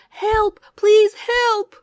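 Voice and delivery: high, loud pleading shout; terrified boy shouting to the village; desperate cry. A narrator's reading. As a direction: beg for help at full voice.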